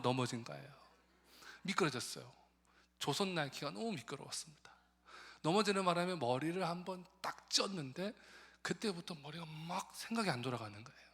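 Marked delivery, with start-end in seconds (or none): none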